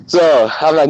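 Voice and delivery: normal talking voice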